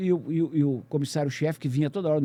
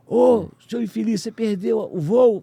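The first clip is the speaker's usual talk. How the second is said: loud, slow, higher yell; incredulous yell; 7 semitones higher, 8 dB louder, slower than usual. Retold or imitated. Colleague on the phone yelling at him in disbelief. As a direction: shout it down a bad line.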